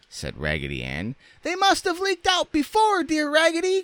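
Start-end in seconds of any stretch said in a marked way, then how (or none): 1.4-3.8 extremely high squeaky chorus; dolls crying out. A narrator's reading. Dolls agreeing with their friend, crying out. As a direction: pipe it up even squeakier, in falsetto. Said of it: "cried all the other dolls".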